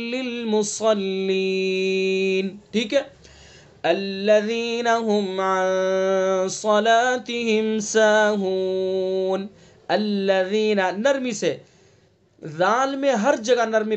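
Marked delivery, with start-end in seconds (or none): 0.1-2.6 للمصلین drawn out slowly with a full, heavy ṣād. Shown as a demonstration. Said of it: "صاد کو پر". 3.8-10.8 slow, chanted recitation of the verse; Quran recitation. Acted.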